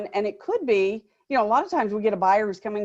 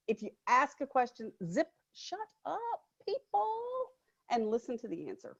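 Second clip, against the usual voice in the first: hushed; 3 semitones higher, 10 dB quieter than usual. Real, not acted. Advice said softly, near whisper.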